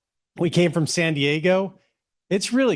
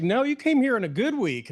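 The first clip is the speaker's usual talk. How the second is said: higher imitated Texas drawl, reassuring; 9 semitones higher than usual. Texas bank woman reassuring the newcomers, light imitated Texas drawl. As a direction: gently mimic a Texas drawl. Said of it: "a just beautiful Texas drawl".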